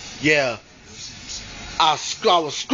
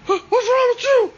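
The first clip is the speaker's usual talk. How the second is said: frightened cry. Retold or imitated. Boy crying out at the twitching ghost. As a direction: cry out in fear. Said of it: "And I'm like".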